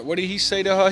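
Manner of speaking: ordinary talk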